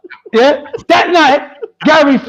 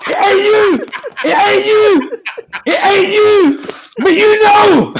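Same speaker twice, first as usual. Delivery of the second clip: high-pitched teasing; 8 semitones higher than usual. Real, not acted. Host teasing colleague over the joke, high-pitched teasing. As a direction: tease in a high, stretched voice.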